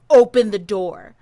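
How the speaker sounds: loud urgent command, falling at the end; shouting to her husband to open the door. Retold or imitated. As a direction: bark it out to Patrick, urgent. Urgent call to husband in car.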